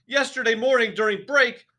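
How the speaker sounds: enraged headmistress; furious tirade. A narrator's reading. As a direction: pound every accusation home. Trunchbull's tirade about the cake.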